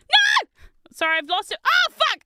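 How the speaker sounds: high, loud frustrated yelp; real frustrated outburst; dismayed cry. Real, not acted. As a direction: yelp as the piece disappears. Loses Lego piece.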